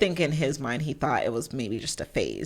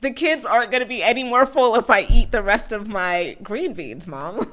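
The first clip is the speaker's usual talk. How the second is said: put-on whiny kid; 8 semitones higher, 8 dB louder than usual. Child voice arguing with mom.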